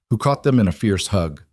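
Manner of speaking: plain reading voice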